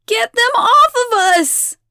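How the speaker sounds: high, loud panicked yell. A narrator's reading. Abigail yells as crows peck at her, panicked yell. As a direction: yell for help at full voice. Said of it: "Abigail yells".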